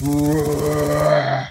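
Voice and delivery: loud booming beast roar; imitated monster roar. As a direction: roar like a big tiger or lion. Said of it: "I apologize for my sound making".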